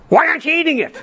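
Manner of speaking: high squeaky mock yell, laughing. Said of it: "I yelled".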